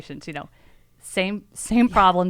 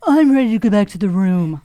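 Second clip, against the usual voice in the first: put-on bored teen son; 10 dB louder than usual. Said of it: "he's like".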